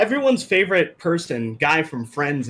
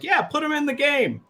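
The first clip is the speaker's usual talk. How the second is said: high, breezy yes-man; lightly voiced eager producer; mock producer voice; 12 semitones higher than usual. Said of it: "They're like".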